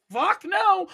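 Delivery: high, loud outburst at friend; retold yell. Friend shown an absurd idea, shouted refusal. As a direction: shout it down, hammed up. Retelling telling off his friend.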